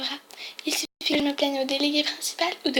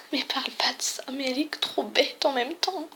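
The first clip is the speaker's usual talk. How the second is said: hushed unvoiced aside to the viewers; whispered aside; 7 dB quieter than usual. Real, not acted. Player mocking the principal, whispered aside. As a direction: whisper it like a secret. Aside comment while playing.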